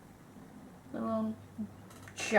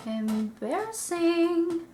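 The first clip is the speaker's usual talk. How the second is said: drawn-out embarrassed; 5 semitones higher, 4 dB louder, much slower than usual. Real, not acted. Judging her messy eyeliner.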